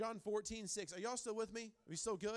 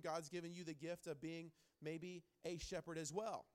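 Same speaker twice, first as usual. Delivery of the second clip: ordinary talk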